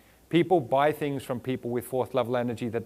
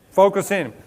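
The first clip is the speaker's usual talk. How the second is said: calls it out louder, pushing the room to focus; rally the crowd, higher and louder; urging; 4 semitones higher, 9 dB louder, slower than usual. Real, not acted. Speaker to distracted audience, louder urging call. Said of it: "Come on now, focus in".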